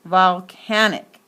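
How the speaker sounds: volcanic loud and slow, CAN accented; oomph on CAN. Shown as a demonstration. Teacher showing a clear accent, CAN stressed. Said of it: "it gets the most oomph".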